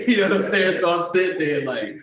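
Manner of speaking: talking through a laugh. Real, not acted. Storyteller laughing at his own arrest, laughing voice. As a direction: laugh while you tell it.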